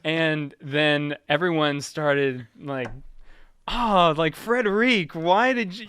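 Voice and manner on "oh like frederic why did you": imitating exasperated classmates, higher and louder; mock scolding. Classmates blaming Frederic for the fart, mock scolding. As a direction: groan it in mock outrage at a classmate.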